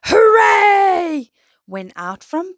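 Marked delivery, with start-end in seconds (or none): 0.1-1.3 high, loud, drawn-out hooray; roaring cheer. A narrator's reading. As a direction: cheer it out like a crowd of monsters. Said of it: "all wave and roar".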